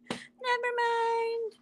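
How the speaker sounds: high, slow laughing repeat. Real, not acted. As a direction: laugh through the words.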